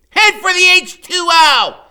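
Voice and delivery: excited shout. Acted. Freak yelling orders while riding.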